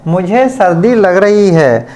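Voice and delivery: ordinary delivery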